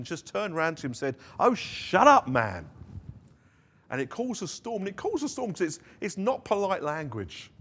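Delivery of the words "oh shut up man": loud, high exasperated snap at a rival; a sudden loud fed-up retort; frustrated outburst. Retold or imitated. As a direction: blurt it out, fed up and louder. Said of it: "in, in frustration".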